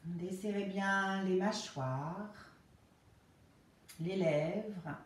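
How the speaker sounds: slow, calm Yoga Nidra guidance with long pauses; guided relaxation. Real, not acted. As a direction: ease them into rest, phrase by unhurried phrase.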